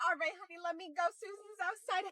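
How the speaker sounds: high, very soft undertone; quiet, high-pitched brush-off; hushed aside. Acted. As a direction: keep it down so she can't hear. Hurried aside before greeting Susan.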